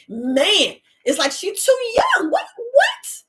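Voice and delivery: retold disbelief. Retold reaction to grandmother's death.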